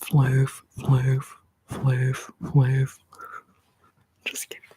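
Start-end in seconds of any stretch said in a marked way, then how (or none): 0.0-3.0 "floof" said as "flohv" with long o and v ending; heathen pronunciation again. Shown as a demonstration. Still mocking the heathen way, "flohv". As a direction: keep saying "floof" the wrong way, "flohv". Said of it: "like a heathen".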